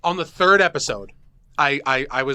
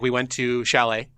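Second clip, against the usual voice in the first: ordinary talk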